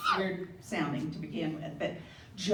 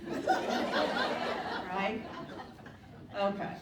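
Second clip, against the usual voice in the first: usual speaking voice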